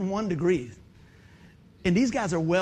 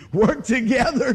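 louder, slow and laughing on the repeat; 4 semitones higher, 7 dB louder, slower than usual. Retold or imitated. Preacher repeating the bell's message, louder, slower and laughing. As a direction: ring it out with joy. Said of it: "the bell's going off".

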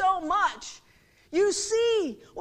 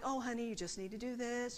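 soft, lower, reassuring motherly advice; soothing mom; 4 semitones lower, 13 dB quieter than usual. Retold or imitated. Experienced mom advising a new parent, soothing. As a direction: imitate a mom gently reassuring.

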